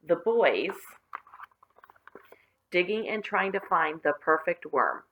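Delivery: ordinary delivery, nothing marked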